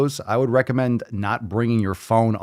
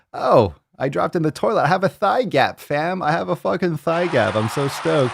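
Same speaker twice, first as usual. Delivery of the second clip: higher-pitched, gushing mock glee; imitating stoked listener; 6 semitones higher than usual. Mimicking Natasha bragging about her thigh gap, mock gushing. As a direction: play her as thrilled over the toilet drop. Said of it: "You're super stoked".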